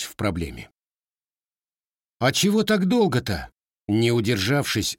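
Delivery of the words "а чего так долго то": put-on higher boyish grumble; grumbling grandson. A narrator's reading. Grandson grumbles at the gunsmith, impatient, higher voice. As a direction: grumble impatiently like a young lad. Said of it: "буркнул внук казака".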